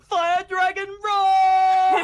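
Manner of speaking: high, slow, shouted battle cry; shrill screeching wyvern. Acted. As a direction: scream the attack name, drawn out. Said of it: "would you like a good impression?".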